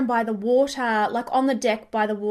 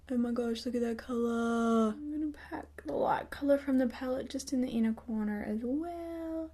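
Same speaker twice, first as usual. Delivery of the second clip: soft, whispery, absorbed murmur; half-whispered make-up commentary; hushed awe; 7 dB quieter than usual. Real, not acted. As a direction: breathe it in admiration, then murmur along.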